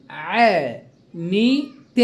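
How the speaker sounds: "عنيت" broken slowly into three stretched, separate syllables. Shown as a demonstration. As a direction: sound out "عنيت" slowly, pausing between each syllable. Teacher splits word into syllables. (نطق «عنيت» ببطء مقطعًا مقطعًا)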